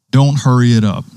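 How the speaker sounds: normal talking voice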